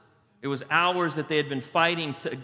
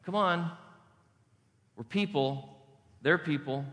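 normal talking voice

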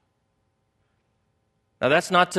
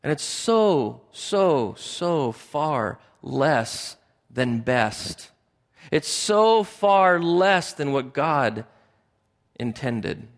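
drawn-out emphasis; much slower than usual. Real, not acted. Preacher lingers on emphasis.

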